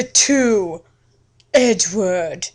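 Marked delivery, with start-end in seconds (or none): none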